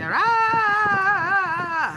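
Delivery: high, loud "Ah" yell swatting a bug; sudden panicked yell; reenacted scream. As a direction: shriek as if beating a bug to death. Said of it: "start yelling and hitting the bug".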